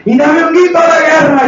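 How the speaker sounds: very high, loud imitation of a concert leader; hammed-up shout to rouse a crowd; mock war-cry call. Preacher mocks concert leaders calling for war cries, high shout. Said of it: "y todos gritan".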